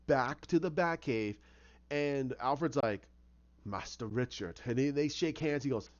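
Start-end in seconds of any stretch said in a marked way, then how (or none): 3.6-4.6 low, soft acknowledgment; understated butler's welcome; reserved butler greeting. Retold or imitated. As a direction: greet him softly and low, with restraint. Butler greeting, acted in retelling.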